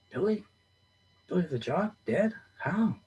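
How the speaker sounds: Billy? thrown up high, stunned; shocked disbelief. A narrator's reading. John stunned by news of Billy, high disbelief. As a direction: hear a friend has died, reeling.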